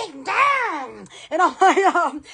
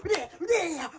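usual speaking voice

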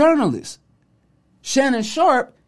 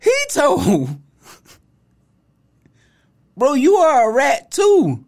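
incredulous; 7 semitones higher, 3 dB louder than usual. Retold or imitated. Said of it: "I'm like".

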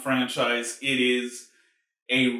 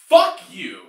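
loud, dismissive curse; angry curse; 3 semitones higher, 7 dB louder than usual. Real, not acted. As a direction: spit it out at the movie. Ranting at the film's line.

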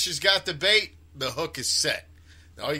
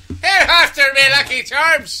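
put-on Irish accent; 8 semitones higher, 10 dB louder than usual. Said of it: "Irish like to fish".

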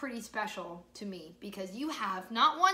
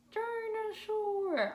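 high, slow held word; drawn-out word; 10 semitones higher, much slower than usual. Pretend. Teacher helps children read the word, drawn out high. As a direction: stretch it out high.

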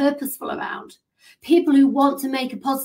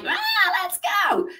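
mock hype shout; 9 semitones higher than usual. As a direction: hype it up mockingly. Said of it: "Their energy isn't like".

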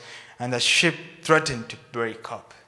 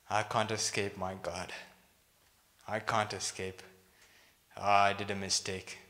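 resigned admission; 6 semitones lower, 9 dB quieter than usual. Acted. Jonah admits defeat, acted.

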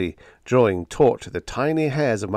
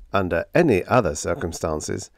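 ordinary delivery

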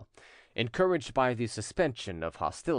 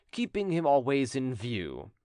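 ordinary delivery, nothing marked

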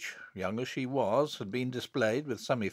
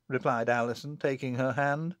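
plain reading voice

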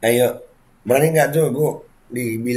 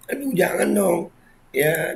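ordinary talk, nothing marked

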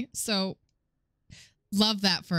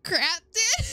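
high retold reaction, breaking into laughter; laughing mock dismay. Player retelling hearing the song, laughing mock dismay. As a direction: squeak it out as laughter takes over.

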